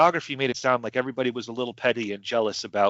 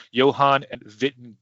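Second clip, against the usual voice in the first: ordinary talk, nothing marked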